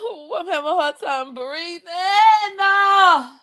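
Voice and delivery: high, breathy fake sobbing; hammed-up fake sob; mock crying. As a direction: ham up the tears. Fake crying over quote.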